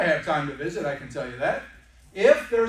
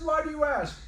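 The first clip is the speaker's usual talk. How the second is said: much higher, bright mock-cheerful; cheery salesman reply; 10 semitones higher than usual. Salesman answers the impatient guest, in a much higher mock-cheerful voice. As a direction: play the overly chipper host. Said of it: "I said".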